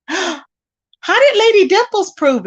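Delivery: slow, high gasp of shock; stunned, drawn-out question; gasping surprise. Real, not acted. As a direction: gasp and ask in disbelief.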